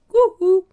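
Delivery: mock woo-hoo. Mocking exclamation.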